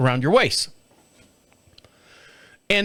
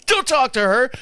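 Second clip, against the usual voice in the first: higher-pitched scolding as the wife; mock wife scolding; 7 semitones higher than usual. Husband imitating his wife's higher-pitched scolding. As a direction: mimic the wife telling him off. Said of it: "if my wife's in the room".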